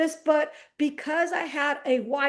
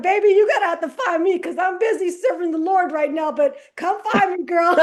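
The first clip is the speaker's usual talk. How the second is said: high, louder playful flirting; flirty call to future wife; 6 semitones higher, 5 dB louder than usual.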